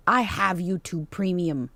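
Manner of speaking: usual speaking voice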